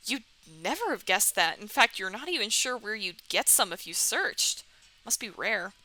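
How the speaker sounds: ordinary delivery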